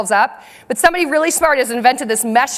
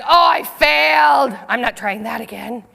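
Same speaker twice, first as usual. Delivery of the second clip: mock defeat; slower than usual. Imagined defeated child, acted out.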